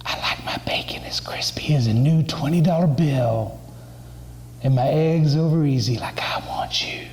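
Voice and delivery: breathy stage whisper, imitating a flirting woman; hammed-up sultry whisper; Rosie's seductive come-on. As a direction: whisper it like Rosie leaning across the table. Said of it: "She was like".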